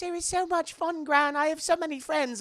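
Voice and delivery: high, bright fake-happy girl voice, forced cheer; fake cheerful. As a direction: lie to Gran with a big sugary high voice. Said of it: "she goes, to Gran".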